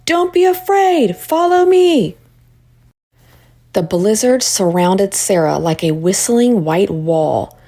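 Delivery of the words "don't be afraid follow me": yelled encouragement. A narrator's reading. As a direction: lead them on through the storm. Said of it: "Miss Freeman yelled".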